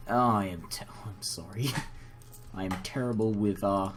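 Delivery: ordinary delivery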